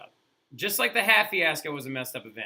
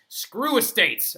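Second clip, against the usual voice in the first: slow, loud and higher; defiant outburst; 9 semitones higher, 7 dB louder, much slower than usual. Retold or imitated. Third Estate defiant.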